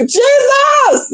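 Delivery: high shouted Jesus in disbelief; shocked shout. Retold or imitated. On seeing him on the app, a high shocked shout. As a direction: scream it out in shock.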